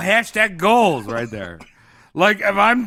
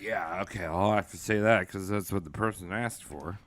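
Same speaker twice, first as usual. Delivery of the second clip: much lower, softer demon voice; low obliging possessed murmur; compliant demon voice; 10 semitones lower, 13 dB quieter than usual. Retold or imitated. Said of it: "the person's supposed to be like".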